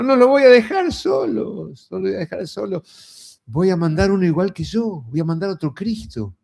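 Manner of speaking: calm them, he won't leave them alone; a hint of Jesus comforting his disciples; reassuring.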